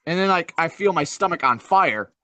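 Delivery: ordinary delivery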